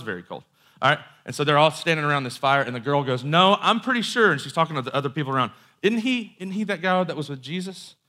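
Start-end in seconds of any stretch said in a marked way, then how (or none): 3.2-4.3 high, loud retold accusation; pitched-up, emphatic contradiction; acted insistence. Servant girl insists before the group. 5.8-8.0 high-pitched accusing question; put-on higher voice, pointing someone out; acted servant girl. Girl appeals to people around her.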